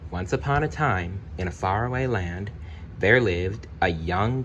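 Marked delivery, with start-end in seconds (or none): none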